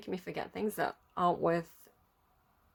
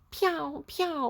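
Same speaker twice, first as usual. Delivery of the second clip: mock blaster pew; 8 semitones higher than usual. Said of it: "that meme where it's like".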